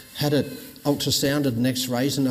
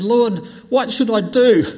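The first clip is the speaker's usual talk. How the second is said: loud, higher plea; crying out; 7 semitones higher, 7 dB louder than usual. Retold or imitated. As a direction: call out to the Lord. Crying out to God.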